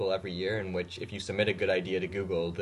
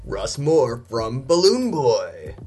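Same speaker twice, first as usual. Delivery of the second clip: announcer voice; 2 semitones higher, 9 dB louder, much slower than usual. Acted. Podcast host announcing a nominee.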